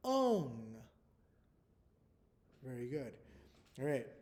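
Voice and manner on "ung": "Ung" held as one long syllable ending in ng; glued sound. Shown as a demonstration. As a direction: draw out "Ung" with vowel and ng joined. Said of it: "to make this glued sound".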